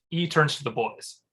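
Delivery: usual speaking voice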